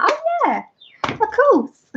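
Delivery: very high squeaky oh yeah of course; mock breezy. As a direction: fake confidence in a squeak. Retold mock confident reply.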